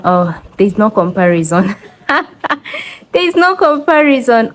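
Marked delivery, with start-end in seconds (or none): 2.1-4.5 laughing through the words; amused, laughing disbelief. Real, not acted. Laughing, repeats emphatically.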